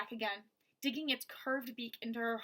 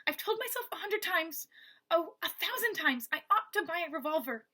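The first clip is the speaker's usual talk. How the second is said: higher, fretful woman's grumble; muttering Eleanor; 6 semitones higher, 4 dB louder than usual. Acted. Eleanor blaming herself while listening at wall, fretful grumble. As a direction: scold yourself under your breath.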